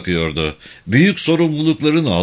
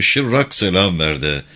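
ordinary delivery

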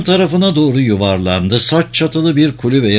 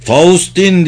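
raised, higher narrated call; blind shout; 5 semitones higher, 4 dB louder than usual. A narrator's reading. Ervan calls blindly for Faustin amid explosions, shouting. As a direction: shout a name out without knowing where they are. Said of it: "diye körlemesine yeniden bağırdı".

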